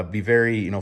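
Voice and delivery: usual speaking voice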